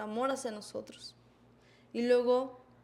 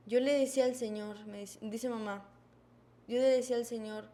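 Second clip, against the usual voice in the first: usual speaking voice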